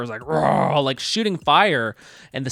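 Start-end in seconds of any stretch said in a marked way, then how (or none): 0.3-0.8 loud imitated beast roar; mock a big monster's roar. Bowser shooting fire in the city, mock roar. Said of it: "this big dragon turtle monster".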